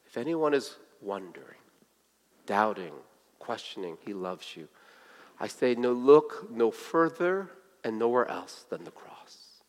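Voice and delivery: low, soft voice with long pauses; hushed appeal. Real, not acted. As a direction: gently reassure the doubters, almost under the breath.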